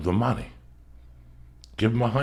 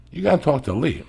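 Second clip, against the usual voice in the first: emphatic retold demand; 6 semitones higher, 5 dB louder than usual. Retold confrontation with comic.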